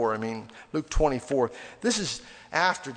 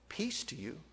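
much softer, hushed greeting; gentle greeting; 12 dB quieter than usual. Acted. Risen Jesus greeting his followers, gently. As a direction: offer it softly as a greeting. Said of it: "must have heard his inflection".

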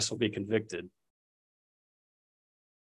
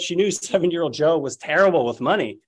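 ordinary talk

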